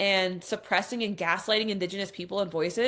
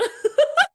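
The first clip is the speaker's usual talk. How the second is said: laughter; 8 dB louder than usual. Real, not acted. Incredulous laugh at article.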